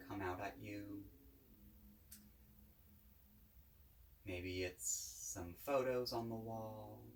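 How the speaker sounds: calm, unhurried guided-meditation voice. Real, not acted. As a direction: guide listeners gently, with long pauses between phrases.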